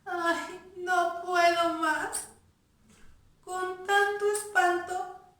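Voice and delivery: high, whiny, hammed-up weeping; mock crying mouse. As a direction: whimper it out like a tearful mouse. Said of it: "el ratón llorando".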